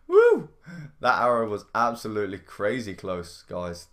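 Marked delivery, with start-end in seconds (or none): 0.1-0.5 very high loud whoop; excited whoop. Real, not acted. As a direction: whoop in relief. Excited after dodge.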